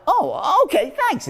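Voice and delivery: high, surprised oh-okay; disarmed reply. Retold or imitated. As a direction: accept it, pleasantly thrown. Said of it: "And they're like".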